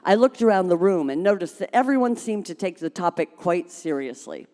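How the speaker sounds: ordinary delivery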